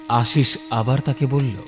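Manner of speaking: usual speaking voice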